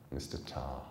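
ordinary delivery

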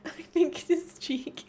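high words breaking into laughter; laughing question. Real, not acted. Streamer checking where the kiss landed, laughing. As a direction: talk through the giggles.

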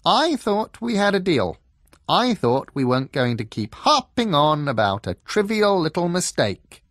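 high, loud indignant protest; defensive fox. A narrator's reading. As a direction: protest like a wronged little schemer. Second voice answers defensively.

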